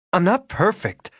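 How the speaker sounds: high, sudden outburst; blurting it out before she can stop; burst out. A narrator's reading. Regan blurting to Rafe, a sudden outburst. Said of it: "The words burst out of her".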